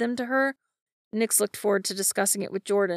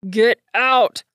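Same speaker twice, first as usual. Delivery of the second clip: slasher killer; 3 semitones higher, 9 dB louder than usual. A narrator's reading. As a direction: bark it like a slasher villain. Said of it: "in his typical serial killer voice".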